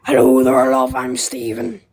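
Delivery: put-on old man voice. Caller greets the streamer as an old man. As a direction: play a doddering old man saying hello.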